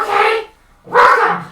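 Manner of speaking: high, loud showy welcome; over-the-top host. Acted. Game master retries the wizard's greeting, high and loud. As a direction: belt it out in a high character voice.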